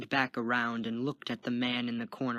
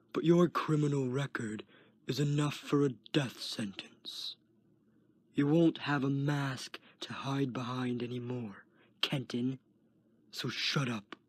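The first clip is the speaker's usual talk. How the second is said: threatening whisper. Acted. Guard threatens in ear.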